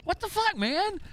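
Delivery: high, fast fake concern, rubbing it in; mocking taunt. Streamer taunting a sore loser with high, fast mock concern. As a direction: needle him with high, quick mock worry. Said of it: "I was like".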